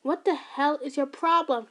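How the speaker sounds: slow, loud furious question; angry yell. Acted. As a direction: yell at mom in anger. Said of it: "he yelled at".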